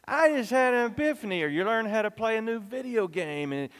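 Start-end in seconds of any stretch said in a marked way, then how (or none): 0.0-1.4 excited. Shown as a demonstration. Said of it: "you say".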